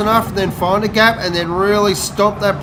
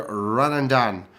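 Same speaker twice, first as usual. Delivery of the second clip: usual speaking voice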